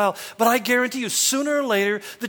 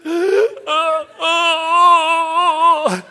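loud, high wheezing gasp with the wind knocked out; gasping for breath; 8 semitones higher, 8 dB louder than usual. Retold or imitated. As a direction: reenact fighting to pull in air after the fall. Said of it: "You sound like you're dying".

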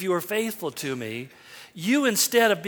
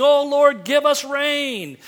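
pleading cry; 5 semitones higher, 5 dB louder, slower than usual. Retold or imitated. Mock prayer of the people.

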